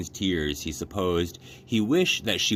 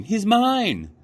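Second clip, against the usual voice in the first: high, loud demand; loud high girl demand; girl screaming; 5 dB louder than usual. A narrator's reading. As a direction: scream for your rabbit back. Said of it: "screamed Abilene".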